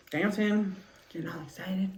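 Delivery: ordinary talk, nothing marked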